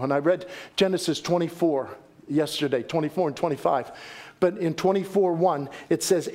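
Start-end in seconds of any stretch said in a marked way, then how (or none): none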